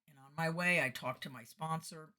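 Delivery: usual speaking voice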